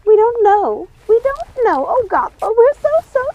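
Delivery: high, loud, distraught apology; fretting. A narrator's reading. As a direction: wail it in a panic. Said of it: "fretting and ripping".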